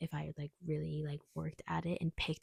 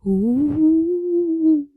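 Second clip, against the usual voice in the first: high, loud ghostly ooh; spooky ooh; 10 semitones higher, 14 dB louder than usual. Acted. Teasing viewers about the spooky video, ghostly wail.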